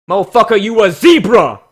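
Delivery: loud, delighted yell; excited shout. Real, not acted. Reviewer yelling happily at a zebra character, loud excited shout. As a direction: hype him up at full volume.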